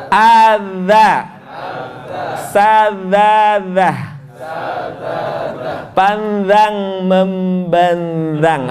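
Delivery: "Adza. Sadzadzah. Panzang membandang" drawn out with buzzing dh; held-air dza drill. Shown as a demonstration. Leading a class drill, slow chanted buzzing dh. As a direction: stretch each syllable slowly, tongue on teeth for "dza". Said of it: "Bunyikan tapi udaranya ditahan".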